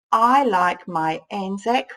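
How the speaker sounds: slow, word by word; drawn-out reading aloud. Shown as a demonstration. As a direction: read it out as you write.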